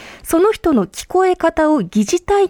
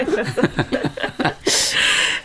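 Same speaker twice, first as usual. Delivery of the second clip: breathy laugh; 6 semitones lower, 5 dB quieter than usual. Real, not acted. Host says only teasing.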